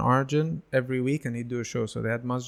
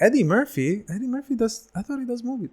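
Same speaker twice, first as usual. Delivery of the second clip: astonished disbelief; 10 semitones higher than usual. Retold or imitated. Teen shocked Eddie Murphy does stand-up.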